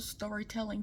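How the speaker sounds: normal talking voice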